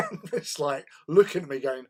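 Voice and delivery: usual speaking voice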